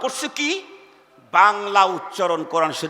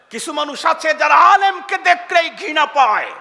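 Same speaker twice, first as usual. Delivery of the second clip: rousing shout; 6 semitones higher, 4 dB louder than usual. Real, not acted. Preacher rouses crowd.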